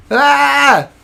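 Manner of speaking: ordinary delivery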